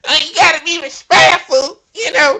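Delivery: high, loud mock Southern twang on gotta; mock Tennessee accent. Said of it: "that damn country ass Tennessee accent".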